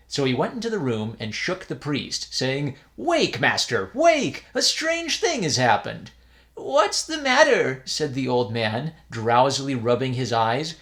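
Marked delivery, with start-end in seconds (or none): 2.9-6.1 high, loud wake-up call; urgent calling. A narrator's reading. Novice shakes priest awake. 6.5-7.8 put-on drowsy old man. A narrator's reading.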